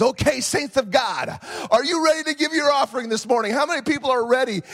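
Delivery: imitated hype preacher, high and fast; rally the congregation like an excitable pastor; hammed-up offering-time call; Pastor Hayward. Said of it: "Pastor Hayward gets up there to do the offering".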